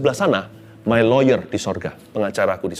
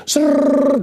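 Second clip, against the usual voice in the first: rolling reel noise; 13 semitones higher, 4 dB louder than usual. Retold or imitated. Imitating film reel rolling.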